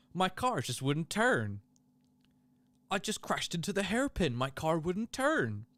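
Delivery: mocking the driver in a Canadian accent. Streamer mocks Latifi's team radio, mild Canadian accent. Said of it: "That's a terrible Canadian accent".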